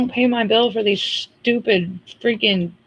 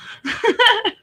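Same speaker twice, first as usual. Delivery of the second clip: high squeal of laughter; 14 semitones higher than usual. Real, not acted. Laughing at the scam call.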